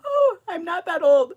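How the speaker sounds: high, loud squeal of protest; mock dismay. Real, not acted. Counting the years since she taught, high mock dismay.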